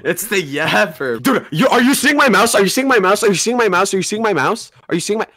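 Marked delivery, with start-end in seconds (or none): 1.2-5.3 higher, insistent rapid-fire repeats; urgent repeated question. Real, not acted. Player urging friend to look at the mouse, insistent. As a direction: keep asking without waiting for an answer.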